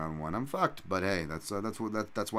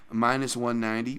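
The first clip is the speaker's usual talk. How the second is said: usual speaking voice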